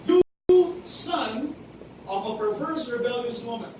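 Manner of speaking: loud, high furious outburst; angry Saul. Acted. Saul cursing his son Jonathan, loud and furious. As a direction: hurl the insult at your son. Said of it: "Saul's anger was kindled against Jonathan".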